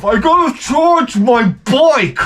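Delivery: a hint of a whiny kid; mocking fat kid. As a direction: mimic the lazy kid's whine. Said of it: "he comes in the house".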